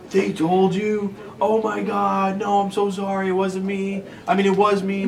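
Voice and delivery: high, loud, drawn-out mock panic; panicked student imitation. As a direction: play a caught kid frantically apologizing. Voicing a caught student panicking.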